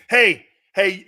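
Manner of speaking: ordinary talk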